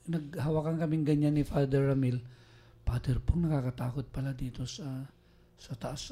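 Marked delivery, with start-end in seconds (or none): 2.8-6.1 fading to a near whisper, shaky fear; retold scared hush. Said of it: "nanginginig ako".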